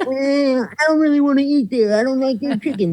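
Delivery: ballplayer voices. Acted. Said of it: "that was your job to be like".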